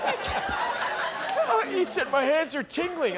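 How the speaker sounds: laughing uncontrollably through the words; can barely speak for laughing; hysterical laugh. Real, not acted. Telling story through hysterical laughter.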